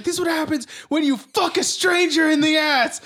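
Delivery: shouted raging threat; shouted movie quote. Retold or imitated. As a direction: yell it like a furious thug. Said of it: "He's like".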